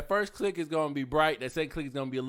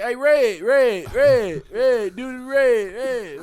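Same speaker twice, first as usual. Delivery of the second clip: high, loud, repeated calling out; mock yelling; 11 semitones higher, 15 dB louder, much slower than usual. Imitating strangers on video chat calling him, yelling. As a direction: holler to get someone's attention across a call. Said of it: "is yelling red".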